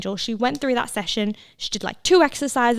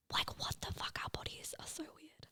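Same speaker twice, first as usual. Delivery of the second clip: whisper; 4 semitones higher, 24 dB quieter than usual. Real, not acted. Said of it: "awed aside to listener".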